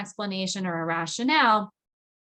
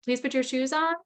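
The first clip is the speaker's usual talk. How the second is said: too tentative; 5 semitones higher than usual. Shown as a demonstration. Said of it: "if you're too tentative in giving the command".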